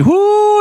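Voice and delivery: a high, thrilled woo; excited whoop. Real, not acted. Host teasing the big news to listeners, excited whoop. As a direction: whoop like a hype man before the news.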